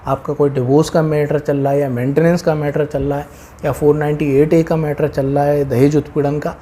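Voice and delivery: ordinary talk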